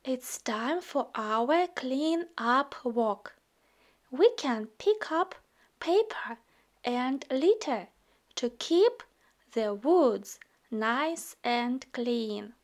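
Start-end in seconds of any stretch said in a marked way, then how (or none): none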